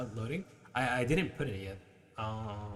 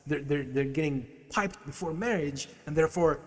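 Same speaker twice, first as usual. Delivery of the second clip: ordinary delivery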